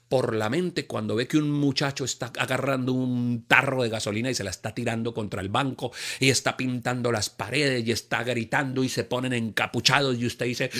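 ordinary talk

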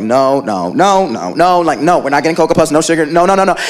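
low firm mom refusing; stern mom. Retold or imitated. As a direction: shut it down like a strict mom. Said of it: "My mom would be like".

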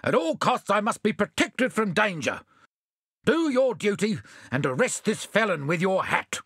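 mayor demanding protection, lightly blustering; bossy mayor. Acted. Mayor to the constable, mildly bossy.

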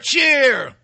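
high, drawn-out imitation of cheering; mock cheer. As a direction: whoop it in mockery. Mimics leftists cheering again.

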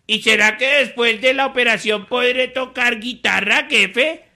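high-pitched hopeful sidekick; Jorgito. Acted. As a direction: ask the boss in a high little character voice. (vocecita aguda de ayudante esperanzado)

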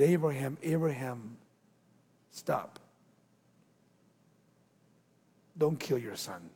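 low, soft, measured command to stop; quiet solemn voice of an angel; hushed angel's plea. Acted. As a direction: halt him gently, low and unhurried. Angel halts the father's knife.

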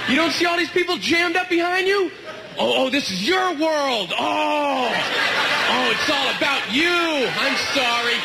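high, drawn-out mock apology; hammed-up exasperation, stretched words; sarcastic rant. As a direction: lay the sarcasm on thick at a passenger. Sarcastic rant at blocking passenger.

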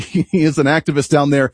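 ordinary delivery

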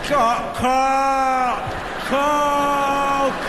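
long held, droning word; exaggerated insistence. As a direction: stretch each Coke out absurdly long. Frustrated customer repeats his order.

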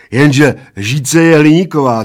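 high, loud triumphant objection. A narrator's reading. Bald man catches out his friend, triumphant. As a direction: score the winning point, loud and high. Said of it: "triumfoval Plešatec".

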